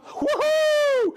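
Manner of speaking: high loud laughing woohoo; big laughing cheer; excited whoop. Real, not acted. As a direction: whoop with joy. Cheering for the mayor.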